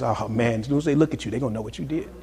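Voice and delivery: low, soft imitated accusation; hushed mock accuser. Preacher voices the devil accusing someone who gave in, hushed accusing. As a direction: drop down quiet and low to needle the sinner.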